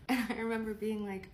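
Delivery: usual speaking voice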